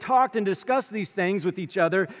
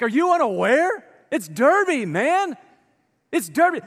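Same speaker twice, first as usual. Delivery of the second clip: higher, louder incredulous jab; mock disbelief; 6 semitones higher, 4 dB louder than usual. Preacher mocks a friend who forgot Derby week in disbelief. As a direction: act amazed they missed the obvious. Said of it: "You're like".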